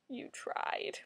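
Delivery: hushed, voiceless whisper; very soft, all breath. Real, not acted. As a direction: whisper it as faint consolation. Consoling remark repeated in whisper.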